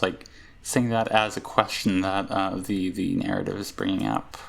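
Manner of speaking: ordinary talk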